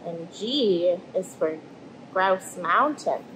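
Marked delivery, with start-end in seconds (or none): none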